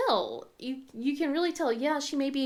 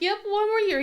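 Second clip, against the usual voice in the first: higher, louder bright confirmation; cheery reply; 9 semitones higher, 5 dB louder than usual. Retold or imitated. Mother answering the principal, higher cheery yep. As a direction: chirp it back brightly. Her own reply retold.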